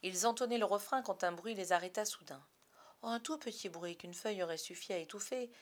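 plain reading voice

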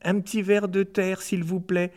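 little high voice pleading for a drink; small pleading voice. Acted. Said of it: "une petite voix qui me suppliait".

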